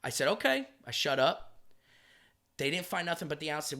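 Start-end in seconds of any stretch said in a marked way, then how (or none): 0.3-0.7 high meek okay. Retold or imitated. Meekly agreeing to cop.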